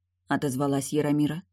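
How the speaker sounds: ordinary delivery, nothing marked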